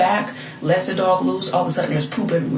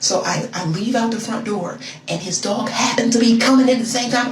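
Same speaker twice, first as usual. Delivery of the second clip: ordinary delivery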